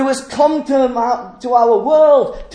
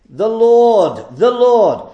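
"Lord" hammered louder each time; stress on Lord; 5 dB louder, much slower than usual. Shown as a demonstration. Preacher driving home the Lord to the congregation, loud stress on "Lord". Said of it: "the stress on the Lord".

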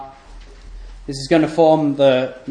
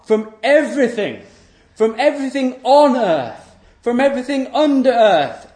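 raised, ringing pulpit voice; preaching at full force; 7 semitones higher than usual. Acted. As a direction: proclaim God's otherness to the congregation. Preacher at full force, God's words.

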